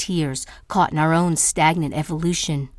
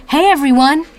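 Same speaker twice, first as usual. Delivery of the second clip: higher, louder sarcastic barker call; mocking announcement; 8 semitones higher, 7 dB louder than usual. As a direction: parody a show-off calling a crowd.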